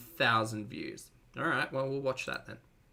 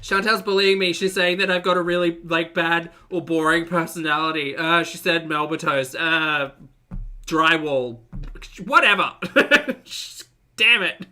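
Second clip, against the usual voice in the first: loud mock complaint; 9 dB louder than usual. Real, not acted. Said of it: "now I'm gonna be a bully".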